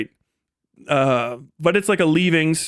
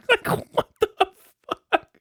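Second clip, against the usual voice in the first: ordinary talk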